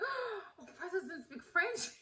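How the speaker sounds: high, soft gasping question; mock stunned disbelief. Naive fan learning Oprah has no French, stunned disbelief. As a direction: act out naive shock, laughter creeping in. Acts out her shocked discovery.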